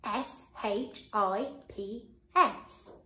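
C A T F I S H, slow, letter by letter; spelling out letters. Shown as a demonstration. Teacher spells catfish for children to check. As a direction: spell it out slowly. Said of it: "Remember to pause the video".

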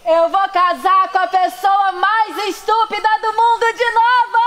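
shouting with joy, high; joyful shout. Acted. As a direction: yell it out happily to the sky. Acted proposal scene shout.

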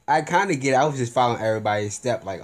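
usual speaking voice

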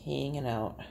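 soft Bay City long A in "Hanging"; Bay City accent. Shown as a demonstration. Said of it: "Long A's".

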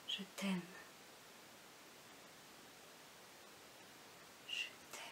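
soft, spaced-out repeats dying to a whisper; fading love poem ending. Acted. Reader closing a love poem to petit loup, fading to a whisper. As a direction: let each declaration fade further away.

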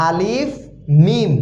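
"আলিফ" lifted, then "মিম" after a short pause; name "আলিফ মিম" as a pair, distinctly; letters named together. Shown as a demonstration. Teacher saying two letters together for learners, measured "আলিফ মিম". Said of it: "দুইটা হরফ একসাথে বললাম".

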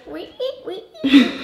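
squeaky high "wee" imitation; mimicking voice. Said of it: "She goes".

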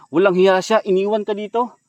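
ordinary talk

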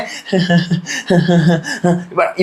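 a short real laugh, dropping off. Real, not acted. Vlogger laughing while retelling the fight, soft laugh. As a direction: laugh softly at the memory.

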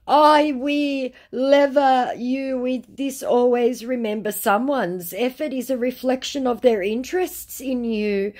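high, loud, over-emotional recital; mock desperate. Scammer making a last emotional appeal, mock desperate.

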